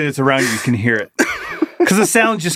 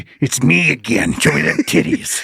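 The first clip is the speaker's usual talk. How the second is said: mimicked creeper come-on. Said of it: "this exact voice, I go".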